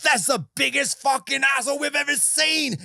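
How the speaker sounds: wild gasping outcry of disbelief; shocked shout. Acted. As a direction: bellow it out like stunned doctors. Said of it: "And they're like".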